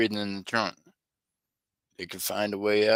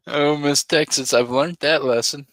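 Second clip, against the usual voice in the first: laughing mock confession; 6 semitones higher, 7 dB louder than usual. Mock confession to Miss Texas.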